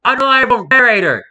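hammed-up shout of an accusation; mock shout. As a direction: call it out like an accusation.

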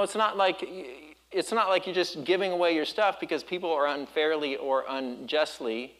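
normal talking voice

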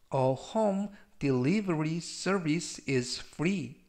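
very slow, clear English sentence; slow reading. Shown as a demonstration. Teacher reads for students to repeat, very slow. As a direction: spell it out slowly for learners to repeat. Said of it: "제가 역시 천천히 세 번씩 읽어드리고요".

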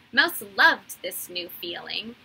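plain reading voice

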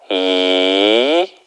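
"이" rising; second tone. Shown as a demonstration. Teacher drilling second tone with students. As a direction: slide "이" upward. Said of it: "이성".

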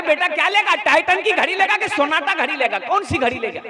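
lightly voiced eager bride's father; coaxing father. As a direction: sweet-talk the groom like the eager father.

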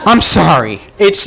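ordinary talk

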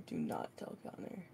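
hushed whisper, stress on not; a conspiratorial whispered warning. Real, not acted. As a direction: whisper it like a secret. Secret warning to friend.

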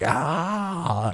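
mock scream. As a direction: let out one high yell at the end of a sprint. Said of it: "screaming like".

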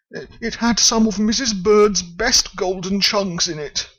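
high, pleading bear voice; upset Paddington. Acted. Paddington explains to the foreman, high and pleading. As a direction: plead over the lost jar.

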